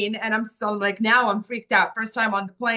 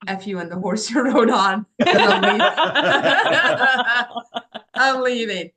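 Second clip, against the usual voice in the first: laughing defiance; 2 semitones higher, 5 dB louder than usual. Retold or imitated. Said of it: "I got so angry".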